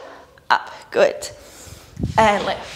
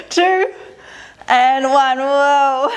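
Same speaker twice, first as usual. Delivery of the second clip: counting while wobbling, higher and louder; balancing on one leg; 4 semitones higher, 4 dB louder, much slower than usual. Real, not acted. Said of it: "Felt like I was on a unicycle".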